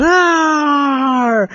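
high, drawn-out mock pirate cry; a boy's playful pirate roar back; pirate arr. Second boy joins pirate roaring.

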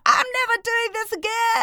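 high, hammed-up vow of dread; mock anxious wail. Organiser dreading her big event, mock anxious wail. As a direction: wail it high, like someone swearing off it forever. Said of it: "are anxiety ridden, you're like".

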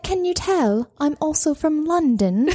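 high, slow mock London accent; attempted British accent. Friend trying to fool people from London, bad British accent. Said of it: "trying to do a British accent".

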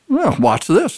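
high, jaunty dare from the dog; cocky dog. Acted. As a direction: toss it off like a cheeky challenge. Dog answers the flower, story.

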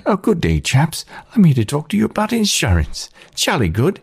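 high-pitched cheery British gecko; British accent. Acted. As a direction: put on a chirpy, high British lizard voice. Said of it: "that has a British accent".

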